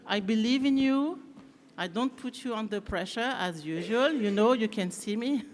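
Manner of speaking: English with a light French accent; French-accented English, lightly done; slight French accent. Real, not acted. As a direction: speak English as a native French speaker would. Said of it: "avec un petit accent français".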